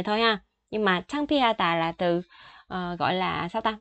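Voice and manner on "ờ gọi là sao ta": usual speaking voice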